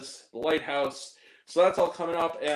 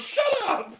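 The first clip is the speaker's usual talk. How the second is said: high-pitched retort, pitch leaping way up; mock outburst. Film character silencing someone over runes, mock outburst. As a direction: cut them off with a squealed comeback.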